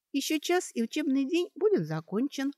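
high-pitched, tentative reminder; timid. A narrator's reading. Kaitri reminds the group about the class, timidly. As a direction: venture it lightly, unsure of yourself. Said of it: "несмело напомнила Кейтри".

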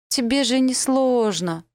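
rising desperate plea. Acted.

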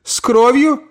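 high sharp rise on кровью; lightly voiced aunt, suspicious; questioning old lady. Aunt questions, Carrington answers.

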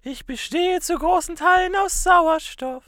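airy musical character. Pretend. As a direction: float it out as the air.

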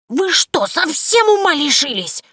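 put-on very high, loud old witch outrage; outraged Yaga. Yaga, furious at the girls, very high and loud. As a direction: pale with anger, slow and shrill. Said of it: "Яга даже побледнела от гнева".

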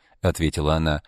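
ordinary delivery

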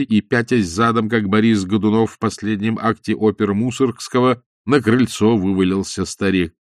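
usual speaking voice

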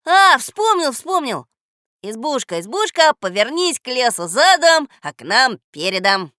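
loud, high burst, then chanted command; excited recall. A narrator's reading. Boy remembering the words to command the hut, excited. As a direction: call out the spell triumphantly.

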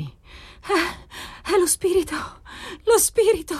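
very high, halting, haunted utterance; eerie high-pitched faltering; stammering, changed voice. A narrator's reading. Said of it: "balbettò lei, con la voce cambiata di tono".

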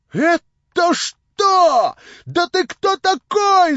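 put-on shrill slow screech of the drunk landlady; shrieking landlady. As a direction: screech it at the intruder like an outraged harridan. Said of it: "завизжала она".

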